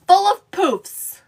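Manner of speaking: drawn-out, emphatic correction; slow and deliberate. Real, not acted. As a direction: spell it out slowly, making sure it sticks. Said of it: "Not cruffles".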